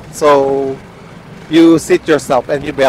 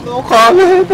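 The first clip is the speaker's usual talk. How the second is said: much higher, teasing copy of the reply; mock squeaky echo; slower than usual. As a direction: parrot him back in a high squeal. Mocking the friend's reply. (mataas na panunukso)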